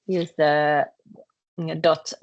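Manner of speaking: ordinary talk, nothing marked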